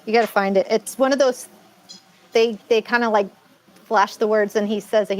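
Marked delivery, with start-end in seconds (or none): none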